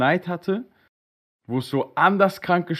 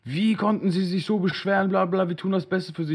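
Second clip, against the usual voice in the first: imitated angry snapping; 5 semitones higher than usual. Customer service man scolds the complaining customer, imitated angry snapping. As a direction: imitate an indignant rep snapping back. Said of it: "schnauzt mich voll und sagt mir".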